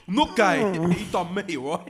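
loud, protesting whale; indignant whale voice. Pretend. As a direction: insist loudly, wounded pride. Voicing the whale defending itself.

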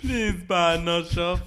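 loud, slow pleading seller; street seller voice. Retold or imitated. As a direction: beg passers-by slowly. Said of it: "That bloke selling the big issue".

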